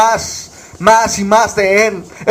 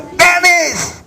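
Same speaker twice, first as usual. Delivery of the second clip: desperate call; 9 semitones higher than usual. Retold or imitated. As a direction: call out the name again, more desperate. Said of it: "esa voz desesperante". (grito desesperado, mucho más agudo)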